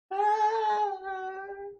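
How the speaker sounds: squealing giddy laugh; high excited laugh. Real, not acted. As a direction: burst into a high giggle. Laugh after hype.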